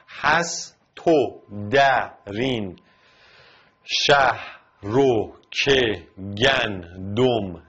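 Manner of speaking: scanning the verse one syllable at a time, slow; slow metric scansion. Shown as a demonstration. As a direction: count out each syllable with pauses. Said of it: "بخش به بخش".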